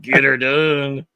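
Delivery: slow, drawn-out Southern catchphrase; Southern accent. Pretend. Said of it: "in a Southern accent".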